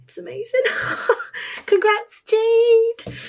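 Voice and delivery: high squeal through a laugh; real high-pitched excited cheer; giddy congratulations. Real, not acted. Congratulating the maker.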